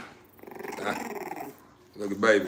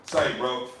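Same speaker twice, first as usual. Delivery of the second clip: ordinary talk, nothing marked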